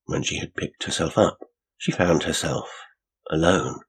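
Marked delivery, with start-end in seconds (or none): none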